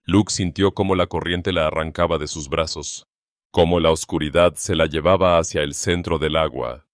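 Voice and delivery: usual speaking voice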